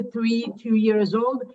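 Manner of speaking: ordinary talk, nothing marked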